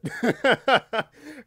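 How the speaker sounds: high wild laugh bursting out; helpless high giggle; burst of laughter. Real, not acted. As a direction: crack up at yourself. Laughing at own ad bit.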